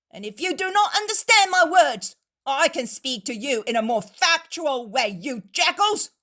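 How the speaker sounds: high, loud furious defiance; angry innkeeper. A narrator's reading. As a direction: stand your ground and throw them out. Innkeeper throws out the Pharisees.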